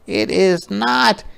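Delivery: put-on high protesting child voice; defensive girl. Ruthie protests to Martin, high and defensive. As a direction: insist it's yours.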